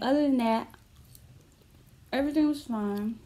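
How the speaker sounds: talking around a mouthful of food; muffled speech while chewing; mouth full, chewing. Real, not acted. Seen 0: Forkful of food enters the mouth, then she talks while chewing it.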